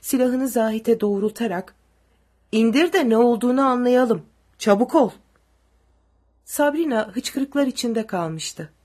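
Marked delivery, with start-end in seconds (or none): 2.5-5.1 loud threatening order. A narrator's reading. Soldier repeats order at gunpoint.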